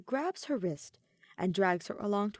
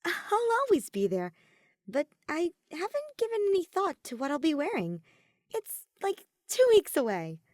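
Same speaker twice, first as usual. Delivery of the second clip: put-on higher, louder, flustered young girl; Soleil, young and flustered; 8 semitones higher, 5 dB louder than usual. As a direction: laugh it off a little nervously, pitched up. Soleil brushes off outfit worry.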